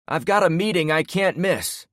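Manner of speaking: high, loud holler after them. A narrator's reading. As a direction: yell it at retreating backs. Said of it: "the guy hollered at our backs".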